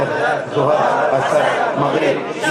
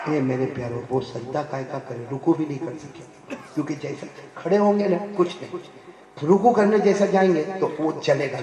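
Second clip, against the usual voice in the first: ordinary delivery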